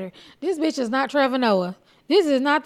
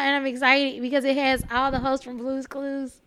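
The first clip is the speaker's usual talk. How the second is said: ordinary delivery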